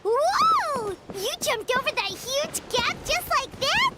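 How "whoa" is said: high surprised gasp of a word; amazed. Real, not acted. Watching a friend clear a huge gap, amazed. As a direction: let the wonder burst out.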